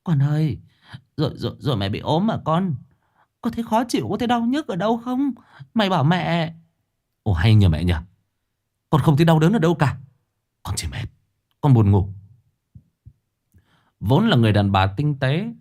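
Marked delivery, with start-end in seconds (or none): 0.0-6.5 very high, fretful, quick pleading; mother begging her sick son to say where it hurts; anxious mother. A narrator's reading. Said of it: "lại càng lo âu". 7.2-12.1 1 irritated. A narrator's reading. Son irritated at nagging mother.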